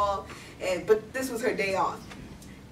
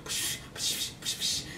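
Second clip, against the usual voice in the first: soft rhythmic bursts of air; oxygen machine hiss; 7 dB quieter than usual. Retold or imitated. Granddaughter mimics that stupid oxygen machine, hissing. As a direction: imitate a pumping oxygen machine. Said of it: "I hear that stupid machine is like".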